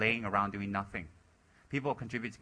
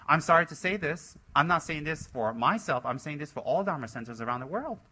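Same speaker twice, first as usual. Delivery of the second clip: normal talking voice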